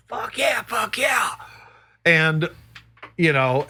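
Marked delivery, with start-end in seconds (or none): 0.1-1.3 excited yelp. Retold or imitated. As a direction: yelp it out, thrilled at the chance. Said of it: "we're going like".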